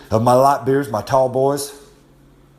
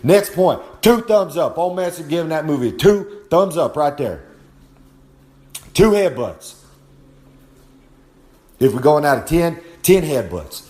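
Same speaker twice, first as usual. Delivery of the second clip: wrestling promo hype; 3 semitones higher than usual. Acted. Old Master rates the film, wrestling-promo hype. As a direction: sell it like a ring promo.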